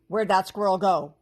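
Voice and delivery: loud goblin yell. A narrator's reading. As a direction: bellow it around the trees. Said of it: "one of them yelled".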